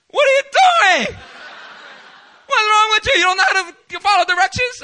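high, loud exasperated yell; retold angry yell. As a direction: scold the helper at full volume. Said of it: "I was like".

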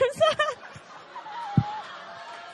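long, high-pitched laugh. Real, not acted. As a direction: burst out laughing after teasing someone. Laughs after teasing a listener.

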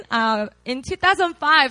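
usual speaking voice

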